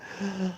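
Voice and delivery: high, sharp hammed-up gasp; mock prude gasp. Mocking prudish gasp.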